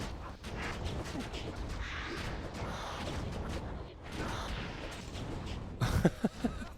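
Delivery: airy, breathless laughing burst; breathy laugh. Real, not acted. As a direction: laugh silently at the drop. Laughing at repeat drops.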